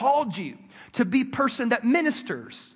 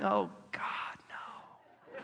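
dismayed whisper; 12 dB quieter, much slower than usual. Retold or imitated. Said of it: "I'm like".

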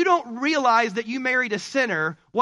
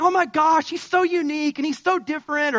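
high, breathless swooning over him; gushing infatuated voice; 6 semitones higher, 3 dB louder than usual. Retold or imitated. Newlywed-to-be gushing about her man, mock swoon. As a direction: gush like a smitten girlfriend. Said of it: "and you're like".

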